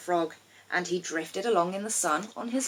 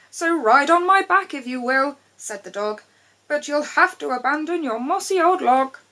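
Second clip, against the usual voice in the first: higher, louder cheerful dog voice; a kindly dog turning him down gently; lightly voiced friendly dog; 7 semitones higher, 5 dB louder than usual. A narrator's reading. Dog speaks to frog.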